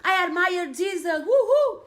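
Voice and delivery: loud, high-pitched gushing with whoops; mock cheering worshipper. Speaker mocking people who only worship Jesus, gushing excitedly. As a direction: cheer like an over-the-top fan. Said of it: "But when you worship Jesus, all you do".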